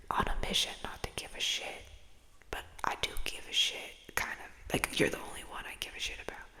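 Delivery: soft, close whispered confession; intimate whisper. Acted. Confiding in her viewers in a close whisper. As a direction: whisper it like a secret to a friend.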